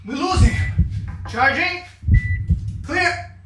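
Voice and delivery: urgent ER doctor voice; frantic medical drama. Pretend. Mock ER scene over the engine.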